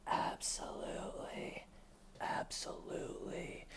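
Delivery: breathy mock whisper, barely audible; hushed imitated denial; creepy whisper. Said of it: "normal talking voice to just".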